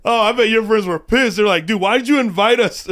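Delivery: high, loud laughing tease; giggling through the teasing line. Real, not acted. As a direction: tease him while still laughing.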